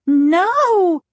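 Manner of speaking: loud, high, horrified cry; drawn-out high scream of horror. A narrator's reading. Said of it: "she screamed".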